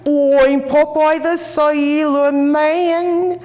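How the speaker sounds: put-on high, loud, slow child bellow; the child yells down from upstairs, loud and drawn out; loudest Yankee voice. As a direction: bellow it out as a brash Yankee kid. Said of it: "the loudest Yankee voice".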